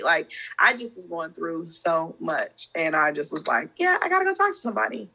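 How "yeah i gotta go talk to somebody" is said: high, fast inner voice; squeaky quick self-quote. Retold or imitated.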